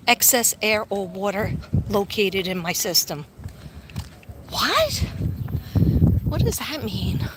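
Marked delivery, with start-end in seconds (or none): 4.5-7.3 puzzled what. Real, not acted. Reacting to the scan result.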